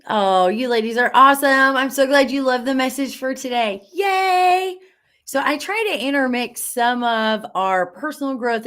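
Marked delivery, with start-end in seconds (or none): 3.9-4.8 high, loud, happy yay; excited cheer. Real, not acted. Host to the ladies who loved the message, excited cheer. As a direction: cheer it out, pitched right up. Cheering at viewers' kind comments.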